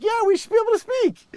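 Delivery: very high, loud squeaky mock voice; mocking free-speech fans. Mocking free-speech supporters with a shrill cartoon squeal. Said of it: "the people that are like".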